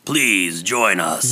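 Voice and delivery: very slow creepy invitation; horror host. Retold or imitated. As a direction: drag it out like a ghoul host. Continuing Roseanne horror intro.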